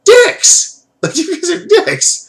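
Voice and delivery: very high, loud falsetto yell; hammed-up high-pitched heckle; mock outburst. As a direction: squeal the insult in comic outrage. Exclaims at the brothers' behaviour.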